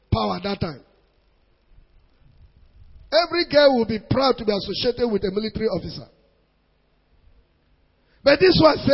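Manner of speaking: ordinary talk, nothing marked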